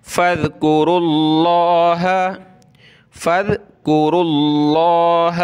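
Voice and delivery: heavy Allah in tajweed. Shown as a demonstration. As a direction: thicken the lla of फ़ज़्कुरुल्लाह. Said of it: "लफ्ज़ अल्लाह को मोटा करके पढ़ेंगे".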